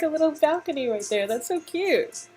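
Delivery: plain reading voice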